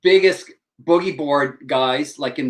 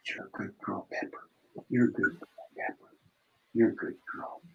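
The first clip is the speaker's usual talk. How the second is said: whispering to the dog; 7 semitones lower, 15 dB quieter than usual. Real, not acted. Praising the dog softly.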